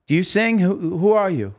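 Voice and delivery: imitated high, insistent quizzing; urgent questioning. Acted quote of man questioning him backstage.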